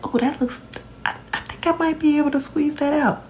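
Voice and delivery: hopeful musing. Retold or imitated. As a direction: talk yourself into it, voice lifted up. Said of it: "I'd be like".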